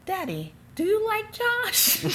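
very high, slow mock little-daughter voice; retold girlish question. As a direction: squeak it out slowly to Daddy. Retells asking her father shyly.

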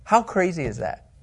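usual speaking voice

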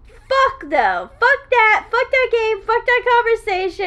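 loud, high exasperated venting; angry. Real, not acted. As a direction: vent loudly, fed up. Venting about an endless argument.

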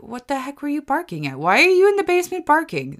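high-pitched, incredulous questioning of a dog; exasperated scolding. Retold or imitated. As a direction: scold the dog in rising disbelief. Acted scolding of barking dog.

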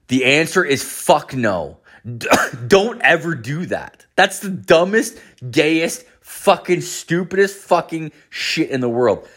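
ordinary delivery

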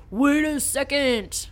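a hint of a different man, drawn out; Vincent voice. Vincent stopping Jerry, lightly voiced. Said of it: "Sorry, my voice changed".

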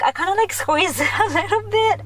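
high, laughing admission; giggly confession. Real, not acted. Confessing to viewers she squeezed his hand, giggly.

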